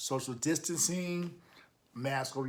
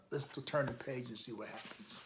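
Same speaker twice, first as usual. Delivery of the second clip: usual speaking voice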